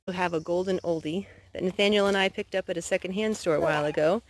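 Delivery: usual speaking voice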